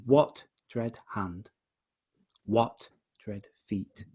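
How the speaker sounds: slow separate words, "what" stressed each time; deliberate slow beats led by "what"; hammered first beat. Shown as a demonstration. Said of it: "hammering it in".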